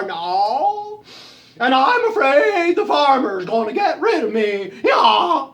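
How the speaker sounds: loud, high, braying complaint; drawn-out donkey wail about getting old. Acted. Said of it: "And the donkey says".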